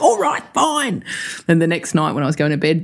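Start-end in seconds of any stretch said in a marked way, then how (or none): 0.0-1.0 high, drawn-out mum giving in; imitating huffy mum. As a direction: concede in a huff, pitched up and slow. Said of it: "She's like".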